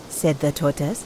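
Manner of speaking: ordinary delivery, nothing marked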